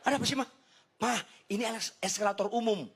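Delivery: high-pitched, protesting husband; acted annoyed complaint, voice pushed up; retold exasperation. Husband argues with wife about public escalator, mock exasperated. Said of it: "Oh, jengkel saya".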